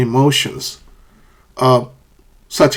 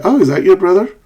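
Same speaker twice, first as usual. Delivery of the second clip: high, louder "oh", rising on "brother"; curious surprised question; 6 semitones higher, 4 dB louder, faster than usual. Acted. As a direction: ask it as if just finding out. Acts someone asking a mistaken question.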